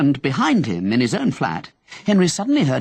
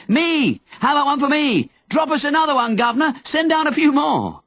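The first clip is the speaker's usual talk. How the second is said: crowd calling out; 13 semitones higher than usual. Acted. Crowd under the balcony calling up, begging. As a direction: beg the man on the balcony for more. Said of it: "started calling out".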